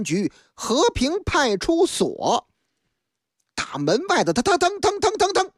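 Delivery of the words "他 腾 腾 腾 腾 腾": high mouth-sound stomping footsteps; imitated footsteps. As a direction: tap out the footsteps with your voice. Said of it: "脚步升级".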